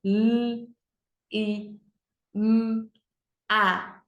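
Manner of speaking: L, I, M, A as "lll, i, mmm, a"; letter sounds. Shown as a demonstration. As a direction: sound out each letter with pauses. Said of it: "Quatro sons". (sonzinho de cada letra: "lll, i, mmm, a")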